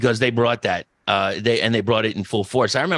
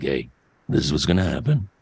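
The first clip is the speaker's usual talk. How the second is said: much lower, calm, unhurried menace; calm Jake the Snake; 9 semitones lower, faster than usual. Retold or imitated. Host imitating Jake the Snake's calm promo to co-hosts, low and steady. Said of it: "he didn't yell".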